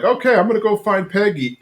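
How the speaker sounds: eager, high character imitation; Bill. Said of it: "and he's like".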